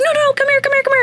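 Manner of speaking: very high calling come here; calling the dog. Retold or imitated. Calling a stray dog off the street, very high. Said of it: "and I was like".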